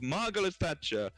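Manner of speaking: high mock call of a passing cyclist; high-pitched imitation of a stranger's call; Italian cyclist. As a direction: imitate an Italian man calling out a name. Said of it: "points at me and goes".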